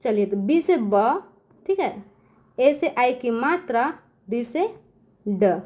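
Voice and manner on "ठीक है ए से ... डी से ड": ordinary talk, nothing marked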